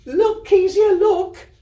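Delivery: very high, loud laughing shriek; girl shrieking at her sister to look. Acted. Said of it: "and shrieked".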